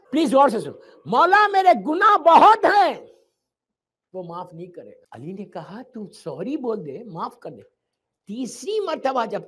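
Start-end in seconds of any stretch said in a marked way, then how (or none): 1.1-3.1 loud, slow anguished plea; despairing cry. Acted. The sinner cries to Ali that his sins are too many. 5.8-7.6 soft, slow reassurance; loving counsel. Acted. Imam Ali lovingly urges the sinner to say sorry. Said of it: "امام نے بڑی محبت سے کہا".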